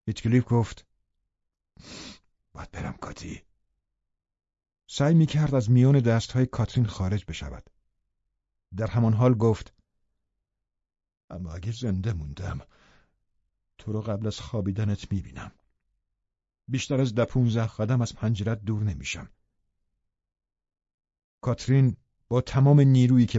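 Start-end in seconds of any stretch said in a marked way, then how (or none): 2.5-3.4 very soft, whispered plea; hushed, voiceless urgency; urgent whisper. A narrator's reading. Lover says he must leave. 11.3-19.3 soft, intimate lover's murmur; hushed promise. A narrator's reading. Lover's hushed promise while leaving.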